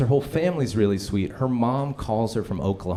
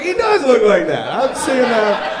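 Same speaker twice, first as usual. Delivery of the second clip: mock audience guy; 12 semitones higher, 8 dB louder, slower than usual. Imitating audience member.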